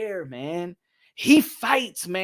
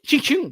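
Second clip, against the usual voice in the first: quick high ching ching; mock jab sound; 9 semitones higher, 5 dB louder than usual. Shown as a demonstration. Boxing streamer miming a quick jab, sharp high sound. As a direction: snap out the punch noise.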